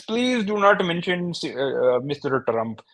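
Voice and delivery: high, pleading mock Indian accent. Immigrant fearing deportation, mock pleading Indian accent. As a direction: beg in a put-on Indian accent. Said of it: "Yeah, the accents".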